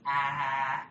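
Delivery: Oli's tiny voice. Retold or imitated. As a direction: imitate a little child's open-mouthed "ah". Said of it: "in that tiny little Oli voice".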